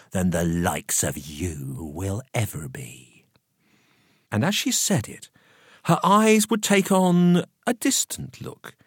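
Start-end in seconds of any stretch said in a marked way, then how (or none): 0.1-3.2 low, slow, soft put-down; slow, near-whispered low voice; hushed disdain. A narrator's reading. Woman putting someone in their place, hushed disdain. Tail of quoted scornful remark.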